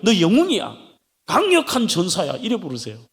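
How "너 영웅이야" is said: ordinary delivery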